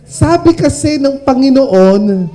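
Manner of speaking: ordinary talk, nothing marked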